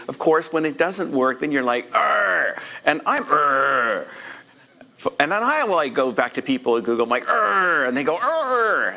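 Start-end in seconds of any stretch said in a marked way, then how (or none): 2.0-2.6 exasperated pirate-like arr; frustrated growl. Retold or imitated. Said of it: "a lot of erring going on". 3.2-4.1 exasperated arr growl; frustrated growl. Retold or imitated. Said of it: "a lot of erring going on". 7.3-7.9 loud exasperated arr; frustrated growl. Retold or imitated. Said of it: "a lot of erring going on". 8.2-8.9 high exasperated arr; frustrated growl. Retold or imitated. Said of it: "a lot of erring going on".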